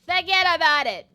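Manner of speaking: put-on New York accent. Gamer trying out the woman's voice, mock New York accent. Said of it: "she has a New York accent".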